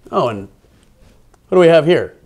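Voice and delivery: ordinary delivery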